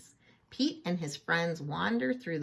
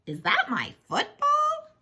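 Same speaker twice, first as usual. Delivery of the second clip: much higher, louder indignant question; surprised Bob; 6 dB louder than usual. A narrator's reading. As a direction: squeak it out as Bob spotting his ball. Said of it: "asks Bob".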